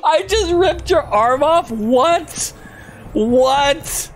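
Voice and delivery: shocked disbelief. Real, not acted.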